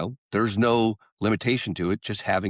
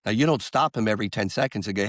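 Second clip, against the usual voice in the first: ordinary talk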